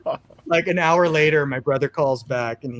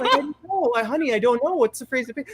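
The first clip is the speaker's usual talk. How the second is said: higher, softer, puzzled mother; mom voice; 9 semitones higher, 3 dB quieter, faster than usual. Retold or imitated. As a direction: answer like a confused mom. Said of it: "she's like".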